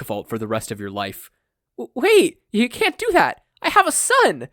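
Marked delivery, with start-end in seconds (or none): 1.8-4.5 much higher and louder, panicked; panicked mother. Acted. Inko pleading for her son, high and panicked. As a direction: beg in alarm, voice high.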